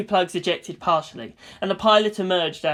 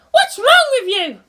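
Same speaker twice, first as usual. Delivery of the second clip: high, louder threats; furious ranting girl; 9 dB louder than usual. A narrator's reading. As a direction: threaten him in rage. Asuka shouting.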